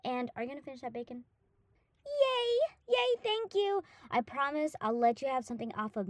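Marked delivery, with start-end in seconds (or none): 2.1-3.8 delighted squeal. Acted. Gets the bacon, delighted.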